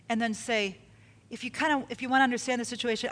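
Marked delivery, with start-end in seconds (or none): none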